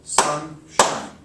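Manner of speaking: "Sunshine" split in two beats, "Sun-shine", a pause between; clapped syllables. Shown as a demonstration. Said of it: "I'm gonna clap each time that I get to a new sound".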